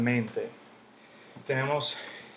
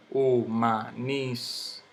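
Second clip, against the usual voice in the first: humanístico split as hu-ma-nís-ti-co; syllable by syllable; much slower than usual. Shown as a demonstration. Teacher sounding out the word for students, slow separate syllables. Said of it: "Yo no digo humanístico".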